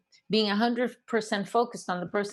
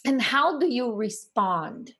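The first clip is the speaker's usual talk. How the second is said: normal talking voice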